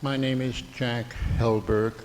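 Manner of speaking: ordinary talk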